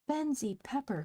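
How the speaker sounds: high, loud delighted call; triumphant, spinning him round; gleeful cry. Acted. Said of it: "cried Polly".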